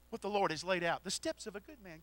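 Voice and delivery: ordinary talk, nothing marked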